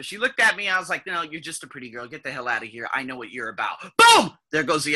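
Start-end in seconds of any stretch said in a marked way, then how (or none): 1.1-3.8 low, quiet dismissal under the voice; dismissive brush-off. Retold or imitated. Narrator silently writing off a pretty salesgirl, dismissive. 4.0-4.3 sudden loud, high burst; punchy exclamation. Real, not acted. Storyteller punctuating his snap judgment, explosive.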